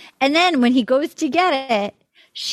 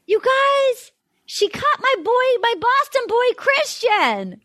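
high-pitched mock wail, drawn out; mock outrage; 10 semitones higher, slower than usual. Host bemoans her cut favorite to co-hosts, mock outrage. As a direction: lament it in a much higher voice.

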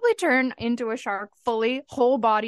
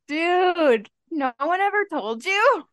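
mock roast; 6 semitones higher, 4 dB louder than usual. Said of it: "I'm just like".

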